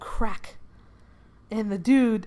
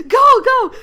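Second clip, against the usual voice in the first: very high, loud panicked yell; acted urgent shout; 14 dB louder than usual. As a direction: yell it like the getaway has to happen now. Fleeing character urges driver on.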